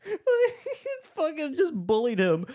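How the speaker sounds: high, slow words squeezed through laughter; laughing. Real, not acted. Podcaster recounting a funny movie scene to co-host, laughing. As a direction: crack up while telling it.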